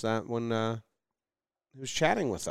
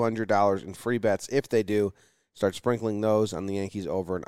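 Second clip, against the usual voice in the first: ad read; 5 dB louder than usual. Acted. DraftKings ad read.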